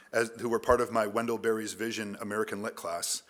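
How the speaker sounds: ordinary delivery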